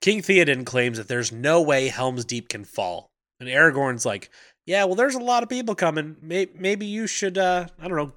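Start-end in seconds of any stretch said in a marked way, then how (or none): 4.7-8.1 higher-pitched, hesitant mock diplomacy; tentative suggestion. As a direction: float the advice carefully, trailing through pauses. Aragorn tentatively advises Theoden.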